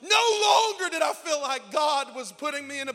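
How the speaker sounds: loud, high shouted refrain building; rising sermon climax; preaching at full force. Acted. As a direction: drive each No longer home.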